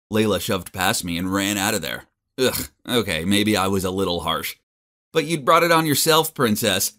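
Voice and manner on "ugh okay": usual speaking voice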